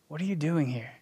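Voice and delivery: gently ask, like a loving father; lovingly. Acted. God gently asking a struggling believer, tender and soft. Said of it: "lovingly say".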